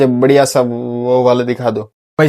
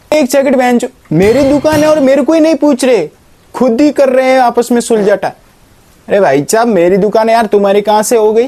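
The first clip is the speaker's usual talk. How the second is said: outraged interruption; 8 semitones higher than usual. Acted. Real owner cuts in, outraged.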